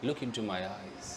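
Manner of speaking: speaking to her lovingly, soft and low. Shown as a demonstration. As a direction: murmur it like a lover.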